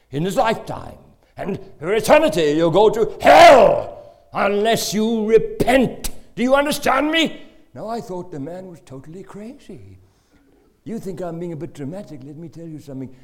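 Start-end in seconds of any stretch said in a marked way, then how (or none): 1.4-7.4 loud, high preaching shout; fire-and-brimstone roar; thundering shout. Acted. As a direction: blast the hell warning at the sinner. Preacher thundering about hell.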